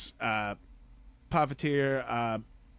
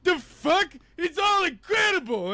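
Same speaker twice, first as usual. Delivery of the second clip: much higher, louder amazed shout; overwhelmed shout; 7 dB louder than usual. Retold or imitated. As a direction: yell it out, blown away. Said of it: "and I was just like".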